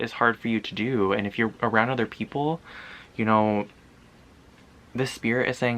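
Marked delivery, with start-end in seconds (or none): none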